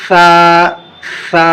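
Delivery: سا with tongue tip between the teeth, lisped; letter demo. Shown as a demonstration. Quran teacher demonstrating سا, tongue between teeth. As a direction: hiss سا through the teeth. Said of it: "ایسی آواز آنی چاہیے".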